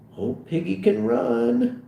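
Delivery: high, slow private musing; drawn-out remark to oneself; said to himself. Acted. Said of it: "he says to himself".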